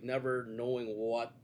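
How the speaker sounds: normal talking voice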